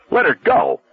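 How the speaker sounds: high urgent shout. A narrator's reading. Arnie shouting at the hitchhiker, urgent high shout. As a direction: yell it to stop him. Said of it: "Arnie was shouting".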